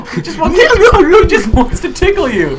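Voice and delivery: high, loud and laughing. Real, not acted. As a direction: laugh through the words. Laughing at monster.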